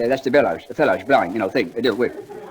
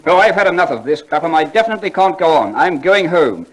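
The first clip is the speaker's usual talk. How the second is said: huffy; 4 semitones higher, 5 dB louder than usual. Acted. Dwyer quitting in a huff.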